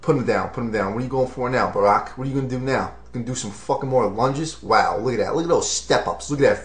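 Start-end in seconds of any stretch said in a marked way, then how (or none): none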